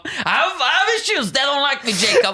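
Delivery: high laughing confession; laughing at himself. Real, not acted. Comic admitting to his friend, laughing. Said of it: "like talking to my uncle".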